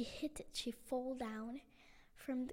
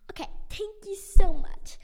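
loud thanks; 7 semitones higher, 22 dB louder than usual. Acted. Luna thanks old lady.

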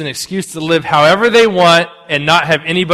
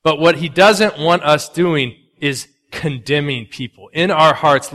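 ordinary talk

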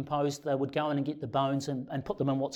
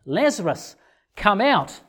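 raised voice, commanding call-out; loud call; 5 semitones higher, 6 dB louder than usual. Acted. Jesus calls Lazarus out of the tomb, loud voice. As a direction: call it out loud and higher at the end. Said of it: "called out in a loud voice".

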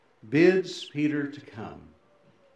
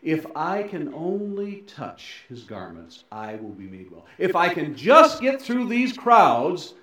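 earnest desire; 4 semitones higher, 7 dB louder than usual. Acted. Woman longing to reach Jesus through crowds, earnest desire. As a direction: build from longing to a loud, urgent reach. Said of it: "but a place of desire".

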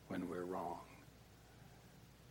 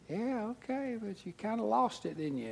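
knowing rebuke; 11 semitones higher than usual. Retold or imitated. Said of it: "I'm arguing".

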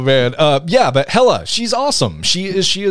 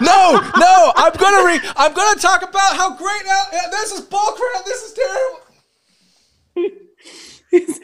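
squeaky laughing complaint, very high; high laughing protest; slower than usual. Real, not acted. Laughing protest while muted.

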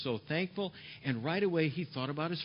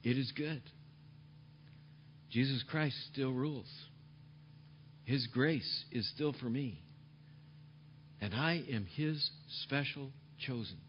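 peaceful; 4 semitones lower, 7 dB quieter than usual. Acted. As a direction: speak it like quiet trust. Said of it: "A peaceful attitude says".

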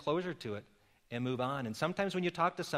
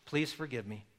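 soft, low, pleading; humble plea; 4 semitones lower, 8 dB quieter than usual. Shown as a demonstration. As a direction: ask forgiveness gently. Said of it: "Apology says".

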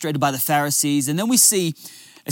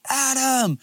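high sing-out of a name; mum yelling out; 9 semitones higher than usual. Retold or imitated. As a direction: imitate a mum calling her boy in from afar. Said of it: "she'd be yelling out".

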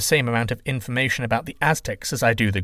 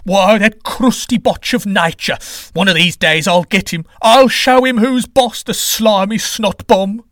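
loud, higher furious ranting; seething rage; 8 semitones higher, 8 dB louder, slower than usual. Acted. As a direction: spit out the insults in fury. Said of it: "a seething Quetzalcoatl ... shaking in rage".

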